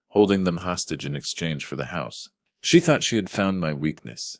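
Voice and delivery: ordinary delivery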